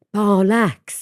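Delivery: Barlacks sung out in a Welsh lilt; Welsh accent. Retold or imitated. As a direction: give Barlacks a Welsh swing. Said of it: "they don't just say".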